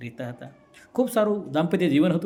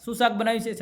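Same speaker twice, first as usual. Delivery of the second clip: higher and louder on સાંભળ, then higher call; mock yell to get her attention; husband shouting from closer up; 6 semitones higher than usual. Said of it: "વીસ ફૂટ દૂર રહીને બૂમ મારે".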